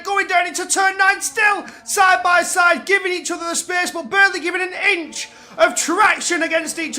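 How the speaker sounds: loud shouted race call; motorsport commentator. Acted. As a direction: shout the live play-by-play. Co-commentator calls the battle.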